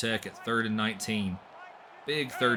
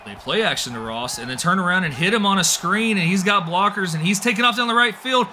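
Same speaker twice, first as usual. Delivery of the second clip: much higher and louder, racing into a full scream; ecstatic touchdown call; 11 semitones higher, 9 dB louder than usual. Real, not acted. Commentator calling the winning touchdown run, screaming in real excitement. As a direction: lose it completely as he breaks free. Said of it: "I'm out of breath here".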